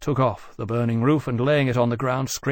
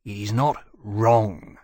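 dissatisfied; 2 semitones lower, slower than usual. A narrator's reading.

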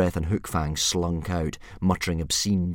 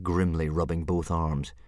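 ordinary delivery, nothing marked

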